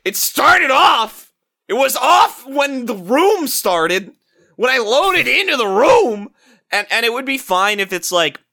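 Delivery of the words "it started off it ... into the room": high, loud, drawn-out protest; exasperated protest. Acted. Player arguing with the guard, mock-exasperated yell. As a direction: yell back, stretching the words out. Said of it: "And I'm like".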